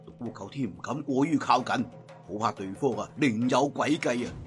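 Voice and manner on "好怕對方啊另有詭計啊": ordinary delivery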